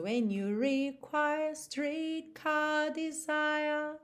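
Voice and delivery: slow sung line, no R after vowels, long vowels; slow British sung lyric; British accent. Shown as a demonstration. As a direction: sing it British, stretch the vowels. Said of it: "Olha quanta diferença".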